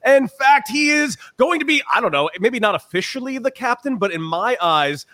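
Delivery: booming high ring announcer intro; ringmaster. Acted. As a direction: announce the champion into the arena. Hyped guest introduction.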